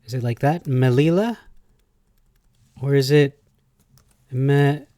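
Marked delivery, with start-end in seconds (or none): 0.7-1.4 "Melila" bunched quickly, higher, rising; quick m'LEE-la?; speed saying it. Shown as a demonstration. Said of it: "are they speed saying it". 2.8-4.9 "Melila" split as meh... LEE-la; syllables kept apart. Shown as a demonstration. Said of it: "are they combining these".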